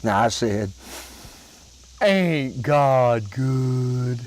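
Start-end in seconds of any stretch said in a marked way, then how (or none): 2.0-4.2 very slow, hushed words of thanks; drawn out like a grateful little prayer; slow reverent aside. Retold or imitated. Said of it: "I said a little prayer".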